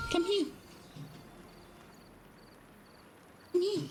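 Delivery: high sing-song come here; calling the cat. Real, not acted. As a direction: coax the cat over in a high voice.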